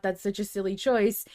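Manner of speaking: ordinary delivery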